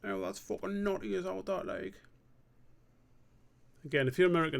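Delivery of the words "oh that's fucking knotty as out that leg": rough London slang, pitched up; chav voice. Acted. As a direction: put on a cocky chav accent. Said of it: "that's a chav voice".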